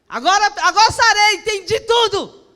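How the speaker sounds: high, loud shouted quote; hammed-up triumphant cry; exultant declaration. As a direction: burst out as if suddenly healed. Acted joyful declaration of healing.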